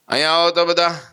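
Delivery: high, loud call to come forward; loud summons. Retold or imitated. Holy man summons the frightened siddhas, loud call. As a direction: summon the whole group sharply.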